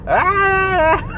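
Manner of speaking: high squawking mock yell; imitated yell. Husband yelling back over the speakerphone, mock high squawk. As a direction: squeal a husband's yell through a phone. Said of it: "he's yelling back at her".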